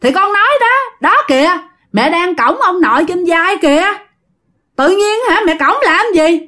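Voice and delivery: put-on high, matter-of-fact little kid; the child explaining to his mother about grandpa, high kid voice; child's voice. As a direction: point it out innocently like a small boy. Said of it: "nó nói".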